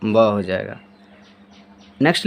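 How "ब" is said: ब said as a short, lower "ba"; sounding out a letter. Shown as a demonstration. Teacher showing pupils how ब sounds, low. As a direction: model the sound ब on its own. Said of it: "उसका उच्चारण क्या हो जाएगा".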